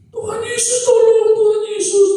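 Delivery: put-on high, wailing child voice; child's desperate prayer. Frightened child calling Jesus for help, desperate prayer. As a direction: imitate a child crying out in prayer. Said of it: "Anak ini berdoa nggak?".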